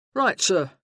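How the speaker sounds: a burly sergeant taking charge; booming soldier arriving in force; gruff sergeant. Acted. Sergeant to Jonathan.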